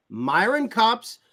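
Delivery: "Kopp's" said with a short "ah", like cop, not "Cope"; slow "Myron Kopp's", the name rhymed with "top"; Cop instead of Cope. Real, not acted. Host reading the quiz option to his Pittsburgh guest, saying "Kopp's" with a short cop vowel. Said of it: "Did you just call it Cop?".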